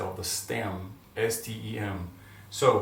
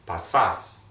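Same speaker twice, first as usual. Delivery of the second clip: "Pasas" drawn out, louder, last syllable stressed; slower model pronunciation; 4 semitones lower, 5 dB louder than usual. Shown as a demonstration. Teacher drilling students on the verb, "Pasas" slow with the final syllable stressed. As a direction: stretch both syllables of "Pasas" for learners to copy. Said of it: "Let's go back, slower".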